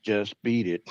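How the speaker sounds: normal talking voice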